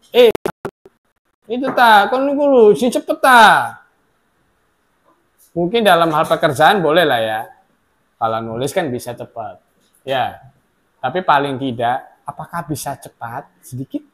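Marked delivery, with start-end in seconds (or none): 0.1-3.8 mock rude urging. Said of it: "Jangan pernah berkata". 12.3-14.0 gentle polite request. Shown as a demonstration. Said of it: "Perkataan halusnya".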